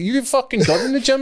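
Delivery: high-pitched, incredulous mock outburst; heated retort. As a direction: retell an indignant phone retort, pitch shooting up. Acted retort in retold phone quarrel.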